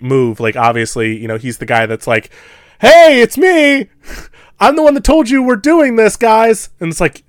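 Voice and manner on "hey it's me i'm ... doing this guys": high, loud, slow cheery announcement; goofy character. Acted. Host acting out the doomed character, high and loud. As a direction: play the eager guy proudly announcing himself. Voicing Pete Davidson's character.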